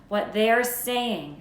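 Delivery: insistent, dragged-out start; pressing on. A narrator's reading. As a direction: push the question at him. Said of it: "she pressed on".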